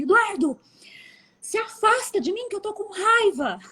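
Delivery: put-on high child voice, telling her brother off; high little-girl voice saying she's angry; imitating little daughter. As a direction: play the small girl warning her brother away. Daughter's angry words retold.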